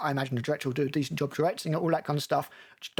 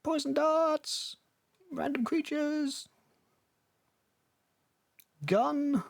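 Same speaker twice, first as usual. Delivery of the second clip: high, slow, sarcastic wonder; mock awe; 12 semitones higher, much slower than usual. Listing the trailer's shots, slow high mock awe. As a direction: drawl each item like a dull wonder.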